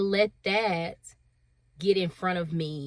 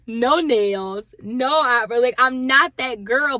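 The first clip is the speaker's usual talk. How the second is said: louder and higher, laughing at herself; exasperated outburst; 5 semitones higher, 9 dB louder than usual. Real, not acted. Venting to viewers about being judged, louder and higher. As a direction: throw your hands up, no nails, no eyebrow.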